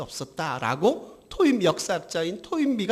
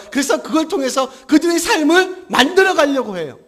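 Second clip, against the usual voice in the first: loud, raised-pitch impassioned sermon; full-force preaching; 7 semitones higher, 9 dB louder than usual. Acted. Preacher lamenting Israel's hearts turned to the world, full-force preaching. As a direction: drive each charge home to the congregation.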